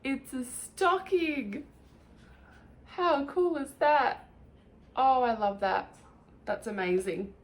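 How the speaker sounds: slow, loud delighted gushing; excited. Real, not acted. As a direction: gush over the gift. Excited unboxing reaction.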